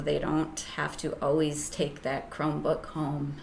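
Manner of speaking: ordinary talk, nothing marked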